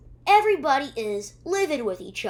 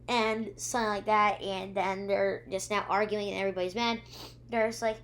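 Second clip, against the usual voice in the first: usual speaking voice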